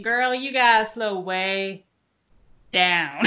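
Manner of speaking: slow Southern talk. Retold or imitated. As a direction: imitate a slow Southern drawl. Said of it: "They definitely talk slow".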